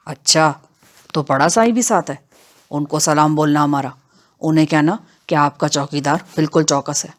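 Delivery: low, unhurried voice of a rustic outlaw; matter-of-fact henchman, low-voiced; brazen bandit henchman. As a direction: pitch it down as a bandit under orders. Bandit Bakhshu speaks in the story.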